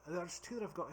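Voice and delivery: halting, imperfect Scottish accent; drunk Scottish accent. Shown as a demonstration. Explaining their attempts at the accent, drunk Scottish. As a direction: put on a tipsy Scottish accent. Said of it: "the drunk Scottish accent's not terrible".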